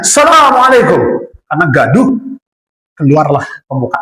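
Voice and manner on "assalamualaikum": high, more forceful call; louder acted shout. Ex-guard nearing the statue, shouting the greeting harder. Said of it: "makin kencang teriaknya".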